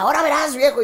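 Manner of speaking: amenaza de broma, más aguda; mamá celosa amenazando. Retold or imitated. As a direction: imitando a la mamá que regaña en broma. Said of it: "Y mi mamá". (la mamá regaña al papá, medio en broma)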